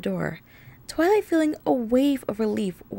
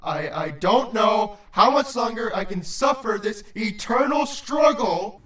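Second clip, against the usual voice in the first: tearful, melodramatic low-voiced outburst; yelled; 3 semitones lower than usual. A narrator's reading. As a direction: cry out in anguish, deep voice. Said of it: "he yelled".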